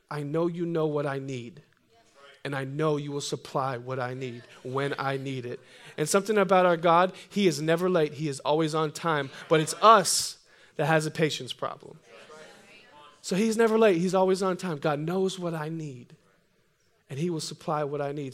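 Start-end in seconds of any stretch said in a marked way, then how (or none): 0.1-5.6 quiet prayer. Retold or imitated. 14.8-18.3 soft confession. Shown as a demonstration. Restating the confession softly.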